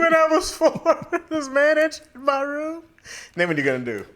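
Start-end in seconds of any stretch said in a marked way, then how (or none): 0.0-2.8 high-pitched, laughing through a hammed-up confession; mock distressed caller. Pretend caller confides trauma to the podcast hosts, mock distressed.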